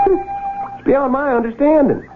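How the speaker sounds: drawn-out, exasperated complaint. Acted. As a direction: throw up your hands at him. Exasperated at friend's playing.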